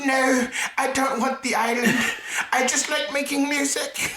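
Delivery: very high-pitched mock singer voice. Comic refusing the offered island, high-pitched impression. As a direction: squeak it out like an Icelandic pop singer. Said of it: "It's like my best [name] impression".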